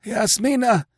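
high, thin cry from far away; faint distant cry. Acted. Dying king calls to his sister from afar, high and distant. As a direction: call her name as if from another world. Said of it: "his voice came faintly and far away".

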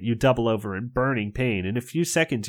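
usual speaking voice

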